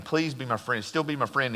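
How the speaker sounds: ordinary talk, nothing marked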